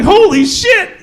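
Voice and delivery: high, loud laughing "Holy shit"; shocked and thrilled; stunned delight. Retold or imitated. Stunned it works.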